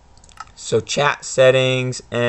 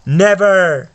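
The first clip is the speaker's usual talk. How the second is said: higher, louder refusal; defiant shout; 6 semitones higher, 5 dB louder than usual. Acted. Harry refusing Voldemort, defiant shout. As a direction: fling it back at him.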